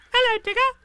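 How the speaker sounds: very high, loud squeak; squeaky little child greeting; Roo. A narrator's reading. Roo greeting Tigger, high and loud. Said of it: "said Roo".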